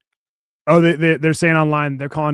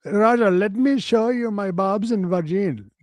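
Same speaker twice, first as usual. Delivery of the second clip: higher and louder mock Indian accent; 4 semitones higher, 4 dB louder than usual. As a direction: insist indignantly in a hammed-up Indian accent. Man voiced in mock accent.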